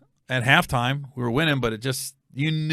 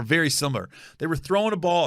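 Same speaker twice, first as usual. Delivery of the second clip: ordinary talk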